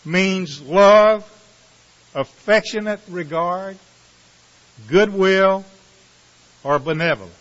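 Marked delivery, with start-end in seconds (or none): none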